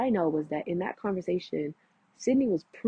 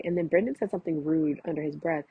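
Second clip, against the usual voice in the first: ordinary talk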